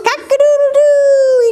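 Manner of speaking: bright morning rooster call; rooster crow. Pretend. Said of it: "Who says".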